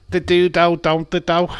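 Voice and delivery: loud, high Scouse "do-do"; Scouse accent, loud and high; Liverpool accent. Pretend. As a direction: ham up a Scouse catchphrase. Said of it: "So it's a Liverpool thing".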